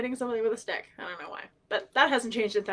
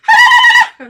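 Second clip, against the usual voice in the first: very high, very loud whoop; piercingly high call across a distance; imitated cousin's signal call; 21 dB louder than usual. Cousin signalling where she is at gathering time, imitated high whoop. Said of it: "there's my cousin Stephanie".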